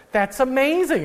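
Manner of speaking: high-pitched retold amazement; amazed. Telling how he first heard an Irish accent, amazed. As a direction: relive being wowed by a new sound.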